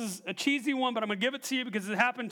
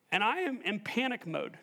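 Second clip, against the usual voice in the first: ordinary talk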